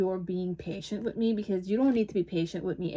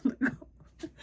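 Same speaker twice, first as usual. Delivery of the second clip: breathy laugh; 7 semitones higher than usual. Real, not acted. Giggles through the role line.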